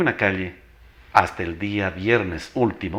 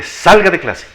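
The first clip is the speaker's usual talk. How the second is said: "Salga de clase" barked higher and louder; strong commanding voice; 7 semitones higher, 9 dB louder, slower than usual. Retold or imitated. Teacher ordering the chatting girl out, loud and stern. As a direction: boom it out like an announcer. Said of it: "con esa voz fuerte".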